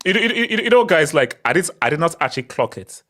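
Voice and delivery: ordinary talk, nothing marked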